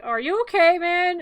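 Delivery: high, loud mock concern; concerned bystander. Crowd to fallen mayor, loudly concerned. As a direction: call out as an alarmed onlooker. Crowd asks after his fall.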